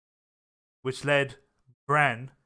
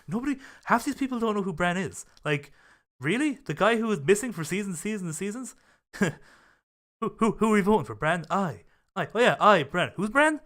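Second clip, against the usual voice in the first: higher-pitched mock outrage, piling on the questions; an incredulous YouTuber ranting about who Bran is, imitated with raised pitch; imitating Critical's disbelief; 5 semitones higher than usual. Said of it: "And he was just like".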